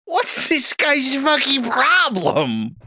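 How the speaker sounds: slow, squawking cartoon duck; Donald Duck voice. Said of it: "Donald Duck sounding out".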